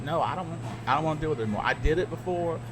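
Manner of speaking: ordinary talk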